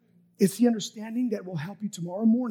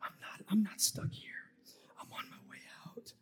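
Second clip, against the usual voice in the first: very soft, breath-only self-talk; self-convincing whisper; 12 dB quieter than usual. Acted. Psalmist talking himself out of the valley, whispering. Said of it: "believing and trusting and convincing himself".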